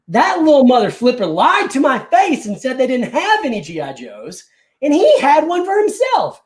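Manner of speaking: high, punched storyteller's indignation; mock outrage. Customer telling how the clerk lied, comically outraged. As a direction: build to a hammed-up cry of injustice.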